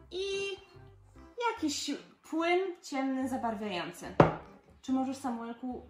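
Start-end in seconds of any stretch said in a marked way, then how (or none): none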